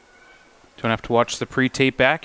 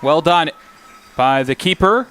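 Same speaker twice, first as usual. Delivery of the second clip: live play-by-play; 5 semitones higher, slower than usual. Real, not acted. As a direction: pitch climbing to a high peak at the save, then easing.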